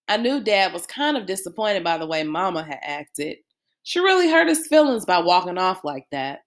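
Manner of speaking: plain reading voice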